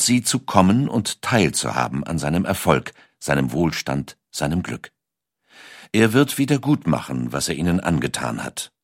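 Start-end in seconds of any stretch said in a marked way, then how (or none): none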